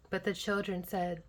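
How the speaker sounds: ordinary delivery, nothing marked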